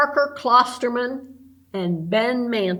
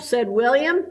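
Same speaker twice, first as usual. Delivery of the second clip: usual speaking voice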